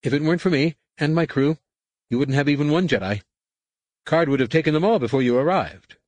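a nervous schemer talking himself up; boastful pleading human. Acted. Imsatad pleads his worth.

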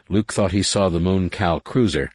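usual speaking voice

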